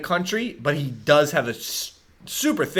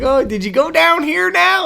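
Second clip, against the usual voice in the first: high, twangy Midwest impression; twangy Midwest accent; 13 semitones higher, 4 dB louder than usual. Podcaster mimicking John Krasinski's real-life speech, twangy Midwest accent. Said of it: "twangy, almost, like, it's weird".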